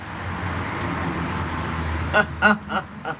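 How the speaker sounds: low, soft drawn-out laugh; long chuckle. Real, not acted. Preacher laughing to himself after his hallelujah, long chuckle.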